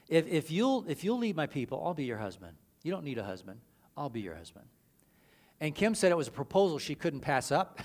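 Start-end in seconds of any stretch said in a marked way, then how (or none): 0.1-4.7 tender Jesus voice. Retold or imitated. Jesus voiced proposing to Kim.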